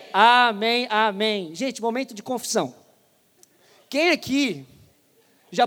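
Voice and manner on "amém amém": speaking in unison. Real, not acted. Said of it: "E todos juntos dizemos".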